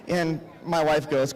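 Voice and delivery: usual speaking voice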